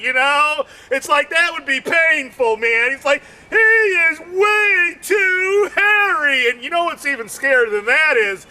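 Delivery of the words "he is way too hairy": high, slow, crying shout; mock wail. Comedian wailing in mock pain at hairiness. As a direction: wail it like it truly hurts. Comic wailing about hairy man.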